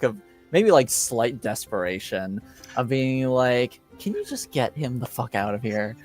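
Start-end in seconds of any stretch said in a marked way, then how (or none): none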